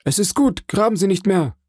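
high, hurried voice of the hidden prisoner; urgent instruction, pitched well up; speaking quickly. A narrator's reading. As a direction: cut off the digging with a quick order. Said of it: "versetzte die Stimme schnell sprechend".

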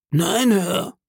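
high-pitched deferential denial; meek sailor. Acted. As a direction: deny it obediently in a high voice. Cowed sailor answers his questioner.

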